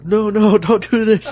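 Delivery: high, loud mock pleading; hammed-up pleading. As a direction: overact a burning man begging. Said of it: "like an actor".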